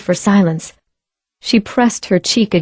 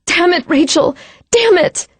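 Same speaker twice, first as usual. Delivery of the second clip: frantic cursing; 10 semitones higher than usual. Acted. Frantic CPR, swearing.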